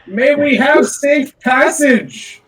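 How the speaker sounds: high, grand, slow royal proclamation. Acted. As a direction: speak like a regal herald. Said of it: "We are using the royal voice".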